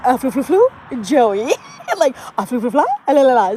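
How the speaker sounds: loud nonsense "flu, flu, flu" in place of French; Joey's botched French. Retold or imitated. Failing Phoebe's French lesson, loud gibberish "la, la, la". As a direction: babble "flu, flu, flu" proudly as if it were French. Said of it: "Phoebe was not getting it".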